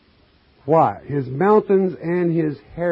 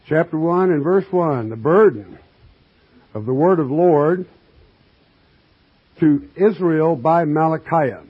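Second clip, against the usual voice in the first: louder, measured pulpit reading; scripture reading; 6 dB louder than usual. Acted. Preacher reading scripture to his congregation, declaiming. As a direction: read the verse out to the flock.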